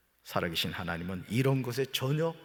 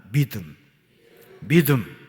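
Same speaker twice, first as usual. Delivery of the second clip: 믿음 called out louder; call for repetition; 8 dB louder, slower than usual. Shown as a demonstration. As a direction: call 믿음 out for the congregation to repeat. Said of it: "따라합시다".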